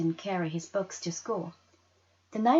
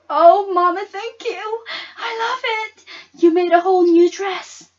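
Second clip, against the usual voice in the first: high, loud, gushing thanks; overjoyed; 12 semitones higher, 14 dB louder than usual. Acted. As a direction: burst with delight at the gift. Girl sees remade dress.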